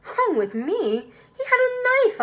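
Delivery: high, loud, outraged disbelief; indignant exclaim. Acted. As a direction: protest in shock. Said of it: "I exclaim".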